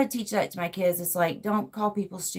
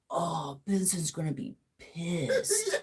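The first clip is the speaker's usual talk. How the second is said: slow, softer cartoon quote, last word drawn out; quoted cartoon dread; 7 dB quieter, slower than usual. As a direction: lightly imitate a cartoon character dreading trouble. Quotes cartoon character's dreaded line.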